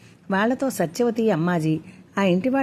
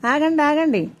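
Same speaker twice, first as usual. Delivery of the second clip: raised high call to stop; calling for calm; 8 semitones higher, 4 dB louder than usual. Acted. Appayamma steps in to stop both sides, loud high call. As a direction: cut the quarrel off loudly. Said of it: "అరచేత్తో వాళ్లను వీళ్లను వారించింది".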